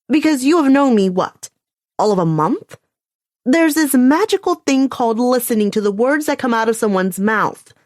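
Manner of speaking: a sharp, scornful fine; angry retort. A narrator's reading. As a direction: cut him down for presuming. Calliope snaps back at Jackson.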